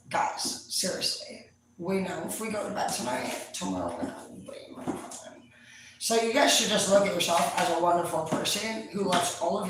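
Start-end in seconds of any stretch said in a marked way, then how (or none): 0.1-5.4 soft whisper to her own demons; whispering to inner selves. Retold or imitated. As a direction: hush them like a secret deal. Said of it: "talk to all my selves and be like".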